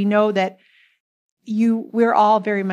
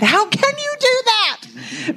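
slow, much higher, drawn-out incredulous question; baffled disbelief; slower than usual. Retold or imitated. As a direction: mimic the husband's puzzled disbelief, stretching each word. Wife retells husband's baffled reply.

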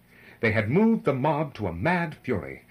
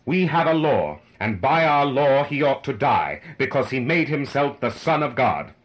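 loud, raised declaiming voice; priests' declaration; 5 semitones higher, 7 dB louder than usual. A narrator's reading. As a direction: proclaim it forcefully as an accusation. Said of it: "But the priests declared".

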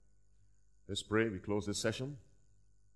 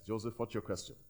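normal talking voice

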